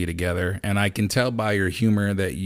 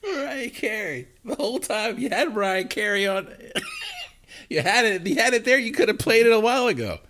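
much higher, amused and incredulous; laughing disbelief; 14 semitones higher than usual. Real, not acted. Host reacting to the [name] mix-up, laughing disbelief. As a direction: squeal it out through the laughter.

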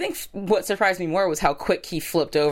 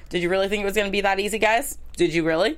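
ordinary talk

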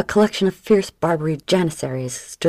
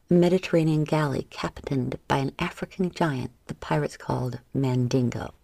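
ordinary delivery, nothing marked

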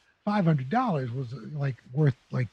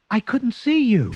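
disappointed mother; 7 semitones higher, 5 dB louder, slower than usual. Retold or imitated. Mother voiced in story.

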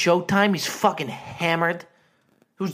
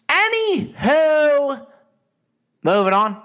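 drawn-out high loud "Anywho"; big goofy segue; theatrical sing-song; 11 semitones higher, 5 dB louder, slower than usual. Pretend. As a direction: stretch it like a showman, then move on. Segment transition, loud.

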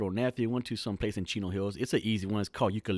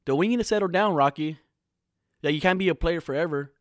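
mocking the family's lecture, higher and louder; singsong scolding like a preachy parent; nagging parents; 5 semitones higher, 6 dB louder than usual. Family lecturing son about settling down, mocking nag. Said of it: "they really think I'm the bad guy".

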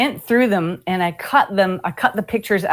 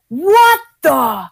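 higher, louder cry of disbelief; mimicked shocked outburst; 6 semitones higher, 5 dB louder than usual. Storyteller plays the drummer finding the pictures, mock shocked shout. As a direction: imitate his stunned yell. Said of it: "he's just like".

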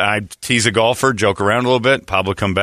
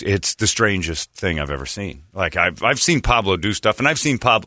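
ordinary talk

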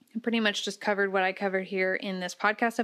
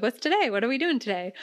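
eager horse voice; 4 semitones higher, faster than usual. Retold or imitated. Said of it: "They're like".

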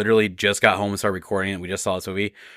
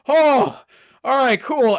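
excited relief; 14 semitones higher, slower than usual. Retold or imitated. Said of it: "I was like".

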